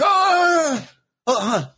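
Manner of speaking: a hint of a flustered bodybuilder, way up high; Swiss bodybuilder. Bodybuilder insisting to Kobe, lightly voiced and high. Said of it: "the Swiss bodybuilder's like".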